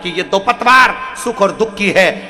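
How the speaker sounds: forceful, loud exhortation; rousing preacher driving the moral home; full-force preaching. Real, not acted. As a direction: build to a ringing closing command.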